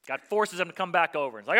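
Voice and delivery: ordinary delivery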